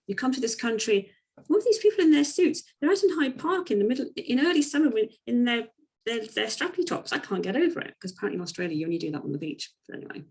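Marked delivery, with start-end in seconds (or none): 0.1-7.9 much higher and louder, mock astonishment; imitating Jo, amazed. Retelling Jo's amazement at Londoners in Hyde Park, high and loud. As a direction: ham up an incredulous outsider gushing about London. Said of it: "I can't do the Australian accent".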